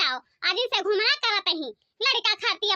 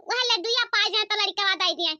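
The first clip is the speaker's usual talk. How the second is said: normal talking voice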